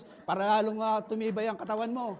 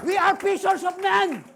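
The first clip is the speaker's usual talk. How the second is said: shouted; 10 semitones higher, 12 dB louder, slower than usual. Acted. First take of the refrain.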